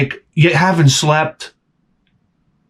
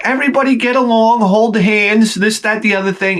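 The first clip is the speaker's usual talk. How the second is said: put-on preachy kid voice; 8 semitones higher than usual. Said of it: "he's like".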